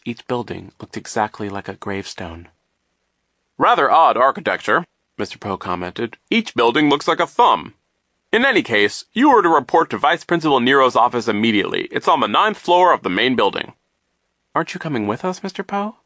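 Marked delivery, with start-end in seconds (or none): 3.6-4.9 loud, emphatic, self-satisfied adult voice; booming, swooping reassurance to children; pompous banker figure. A narrator's reading. 6.3-13.7 loud, higher, emphatic adult voice; pompous, booming instructions; officious banker figure. A narrator's reading. 14.5-16.0 put-on higher, questioning girl's voice; lightly voiced young girl, pitched up; anxious girl. A narrator's reading. Nervous girl asks adult to come.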